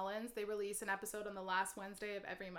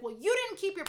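mock scolding; 10 semitones higher, 8 dB louder than usual. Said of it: "I'm not immediately gonna be like".

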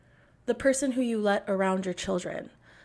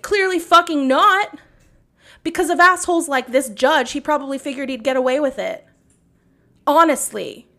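loud, higher furious shout; angry shout; 4 semitones higher, 9 dB louder than usual. Real, not acted. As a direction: yell it at the judge. Shouting at the judge.

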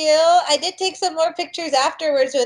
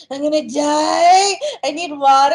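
out of breath; 3 semitones higher, 5 dB louder than usual. Retold or imitated. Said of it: "I'm like".

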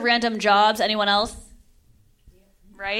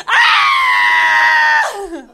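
high, loud laughing shriek; high laughing scream; 13 semitones higher, 8 dB louder than usual. Acted. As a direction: let out a wild laughing Ah. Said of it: "escalating shout".

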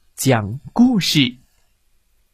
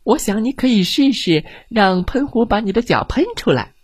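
bubbly fish voice; 7 semitones higher than usual. Acted. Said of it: "咕嘟咕嘟地说".

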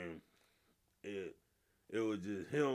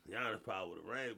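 ordinary talk

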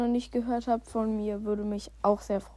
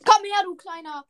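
taunting shout; 6 semitones higher, 7 dB louder than usual. Real, not acted. Taunting opponent mid-fight.

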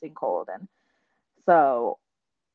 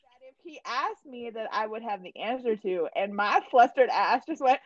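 usual speaking voice

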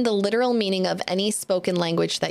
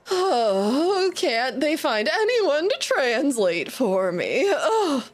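haughty unicorn; 7 semitones higher, slower than usual. Acted. Unicorn flips its hair, dramatic.

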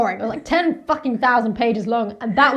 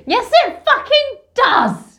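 higher, louder, slow indignant retort; outraged shout; 13 semitones higher, 7 dB louder, slower than usual. Real, not acted. Friend shouting back at the book's Atticus, outraged. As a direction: protest at the top of your voice.

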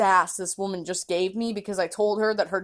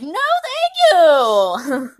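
loud, very slow, drawn-out refusal; exaggerated no thanks; 7 semitones higher, 9 dB louder, much slower than usual. Refusing the lady's help, loud and drawn-out. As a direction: stretch it out, fake polite. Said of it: "And I'm like".

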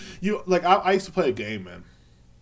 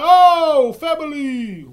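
very high, loud, drawn-out exclamation; mock excited cry; 14 semitones higher, 13 dB louder, much slower than usual. Seeing family's house behind a meth head, mock excited cry. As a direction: call out in hammed-up recognition.

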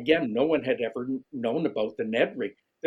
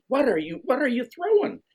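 surprised question; 13 semitones higher, 4 dB louder than usual. Retold or imitated. Retold pro demanding his lure.

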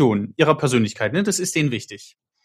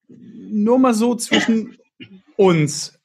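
slow aside; 7 semitones higher, much slower than usual. Real, not acted. Aside after long quote.

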